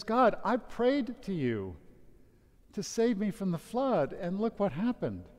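a man in heaven, angry at God; angry complaint. Acted. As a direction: confront God with his grievance. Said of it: "He's angry".

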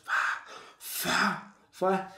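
soft whoosh of cars speeding by; breathy imitation of traffic rushing past; imitated passing cars. (imitando el zumbido de los carros)

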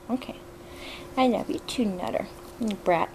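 ordinary talk, nothing marked

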